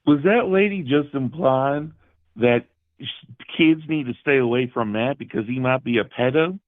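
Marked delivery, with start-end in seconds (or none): none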